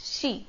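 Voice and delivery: "she" with a hushing sh; sh sound. Shown as a demonstration. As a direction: say "she" opening on श. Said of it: "So this is". ("she", श की आवाज़ से)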